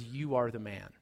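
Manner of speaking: low, soft, grave verdict; quiet accusation. Retold or imitated. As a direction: Nathan drops the truth on David softly. Nathan's quiet accusation to David.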